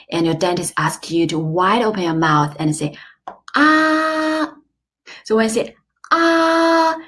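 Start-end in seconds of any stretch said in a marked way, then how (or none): 3.5-4.5 long "ah" held high and level; high flat tone. Shown as a demonstration. Patient saying ah for dentist, "ah" high and level. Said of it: "it's a high flat tone". 6.1-7.0 long "ah" held high and level; high flat tone. Shown as a demonstration. Patient saying ah for dentist, "ah" high and level. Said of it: "it's a high flat tone".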